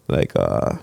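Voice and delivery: usual speaking voice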